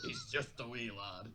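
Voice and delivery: light Scottish lilt on wee lad; a gentle Scottish accent, half laughing. Shown as a demonstration. Friend explaining why the Scottish line felt odd, light Scottish lilt. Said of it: "one of the heavier accents".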